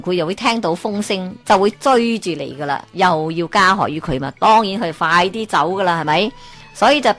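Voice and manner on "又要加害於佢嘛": usual speaking voice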